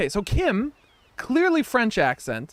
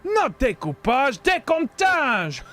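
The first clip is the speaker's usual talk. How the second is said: high, very slow "decomptage" in a hammed-up French accent; mock French accent; 4 semitones higher, slower than usual. Showing off the word in mock French accent. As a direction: stretch "decomptage" out like a fake Frenchman. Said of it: "Oui, oui".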